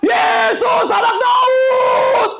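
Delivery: high, drawn-out beggar's cry; long, straining high-pitched yell; desperate shout. Acted. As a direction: cry out over a noisy crowd. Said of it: "Dia teriak".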